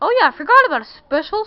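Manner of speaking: sudden loud, fast outburst; excited. Real, not acted. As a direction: blurt it as it comes back to you.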